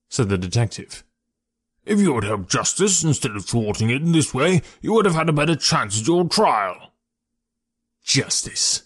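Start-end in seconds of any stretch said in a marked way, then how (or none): 1.8-6.9 high-pitched officious lecture; stern reproof. A narrator's reading. Detective telling the prisoner he harmed his case, reproving. 8.0-8.8 bitter, unvoiced snarl. A narrator's reading. Ex-convict throwing the detective's word back, snarling. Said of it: "snarled the ex-convict".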